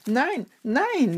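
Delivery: high, swooping protest; desperate cry. A narrator's reading. Peter cornered by the crowd, crying out desperately. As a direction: cry out the denial, pitch leaping. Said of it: "rief Petrus".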